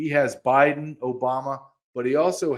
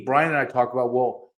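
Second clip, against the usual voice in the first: ordinary delivery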